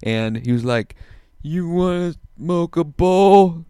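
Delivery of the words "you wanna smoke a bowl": high, loud, slow drawn-out voice; Michael Lehrer impression. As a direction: stretch each word out high and loud. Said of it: "upset by the voice I'm doing".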